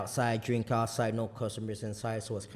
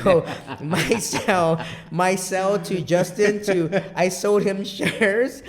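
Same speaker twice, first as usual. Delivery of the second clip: laughing; 7 semitones higher, 12 dB louder, much slower than usual. Real, not acted. Laughing through his sales story.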